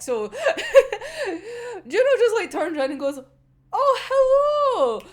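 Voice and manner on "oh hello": high, loud, drawn-out mock hello; teasing greeting. Juno turning round to Aya, exaggerated teasing hello. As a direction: sing out a hammed-up greeting.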